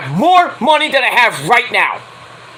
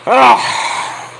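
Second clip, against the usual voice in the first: exasperated groan; 3 semitones higher than usual. Real, not acted. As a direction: let out a fed-up groan. Exasperated before moving on.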